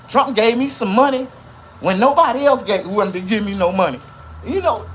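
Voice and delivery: high, loud boasting tirade; loud bragging rant. Real, not acted. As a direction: preach it loud and proud.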